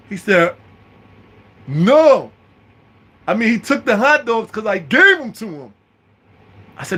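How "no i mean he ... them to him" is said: high put-on voice, loud long "No"; mock a high-voiced, insistent witness; friend's earnest denial. Friend answering the sergeant.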